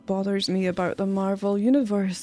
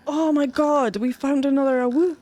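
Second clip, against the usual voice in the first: excited; 6 semitones higher, 3 dB louder than usual. Real, not acted. Excited find in game.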